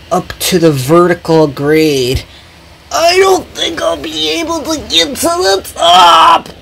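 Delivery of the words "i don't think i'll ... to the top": high, strained struggle; grunting with effort. Acted. Jackie climbing the steep grade, grunting with effort. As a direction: push the words out while straining uphill. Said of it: "grunted Jackie".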